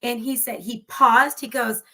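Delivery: ordinary delivery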